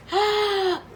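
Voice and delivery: high, drawn-out gasp; excited gasp. Real, not acted. Excited about her bake.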